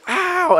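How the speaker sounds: high-pitched yelp of pain; mock hurt girl's yowl; sudden high "ow", acted out; imitated pain cry. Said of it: "you just hear".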